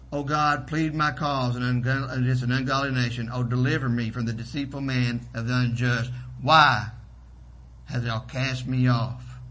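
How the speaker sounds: usual speaking voice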